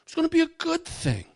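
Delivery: very high, whiny excuse voice; excusing Saul. Retold or imitated. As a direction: squeak out a lame excuse. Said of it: "And Saul explains".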